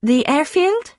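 tentative pupil's answer; uncertain question. A narrator's reading. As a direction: offer it as a guess, lifting steeply at the end. Pupil guesses during engine failure.

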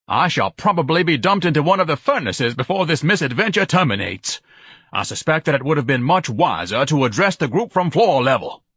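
high, fretful grandiloquence; anxious, haughty lament; pompous Ignatius. Acted. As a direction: complain loftily while dangling. Ignatius complains aloft.

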